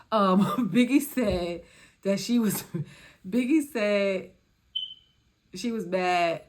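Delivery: loud slow laughing retell; trying to talk through laughter; giggling through the words. Real, not acted.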